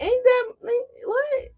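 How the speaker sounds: high, baffled squeak of disbelief; mock stunned what; confused disbelief. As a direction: squeal it, as if the offer makes no sense. Acting confused at a strange offer.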